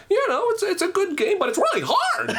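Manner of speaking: squeaky high game-reviewer impression; Pat. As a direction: pitch it way up like a cartoon gamer. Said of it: "here's Pat".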